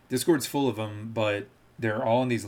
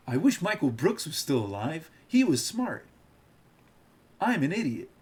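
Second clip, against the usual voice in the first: much higher mocking pundit voice; Sam Seder impression; 5 semitones higher than usual. Mocking a rival streamer to chat in a much higher voice. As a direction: squeak it out, pitched way up. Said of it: "my Sam Seder impression".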